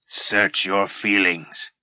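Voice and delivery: lightly voiced, slow evil Yoda; a slow, sinister sage. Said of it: "sounding like an evil version of Yoda".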